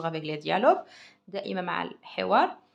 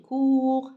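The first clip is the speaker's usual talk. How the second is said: "court" said louder and higher, the ou held; stressed model word; 6 semitones higher, 6 dB louder than usual. Shown as a demonstration. Teacher stresses the ou sound for the class. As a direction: punch "court" to show the ou. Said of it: "Voilà le ou".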